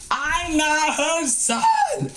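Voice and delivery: high, slow, exasperated protest; a mock-offended thought, stretched out; hammed-up indignant denial, high and slow; indignant inner thought. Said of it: "I didn't say that, but I was thinking that".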